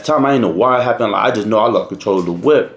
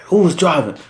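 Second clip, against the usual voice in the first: lightly imitated stern officer, pitched up; raised, mildly stern questioning; stern trooper voice; 6 semitones higher than usual. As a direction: demand it like a trooper questioning a driver. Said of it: "like, a stern ass voice".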